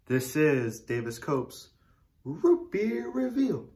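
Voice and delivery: slow announcer voice opening the show; show intro. Acted. Host opening his root beer review, slow announcer voice. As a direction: draw out the title like a TV host, rising high at the end.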